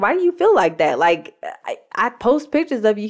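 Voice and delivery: mildly hammed-up 'who, me?' protest; defensive protest. Retold defensive reply to husband.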